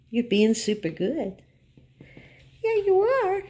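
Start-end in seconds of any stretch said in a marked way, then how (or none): 2.6-3.4 pet talk. Acted. Praising the dog.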